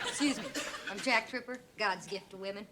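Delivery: low cocky guy voice; imitating Jack. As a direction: strut like God's gift to women. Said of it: "Why don't you be Jack and show us?".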